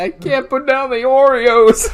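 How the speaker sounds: high, loud, drawn-out whine through laughter; mock wailing. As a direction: wail it slowly, making fun.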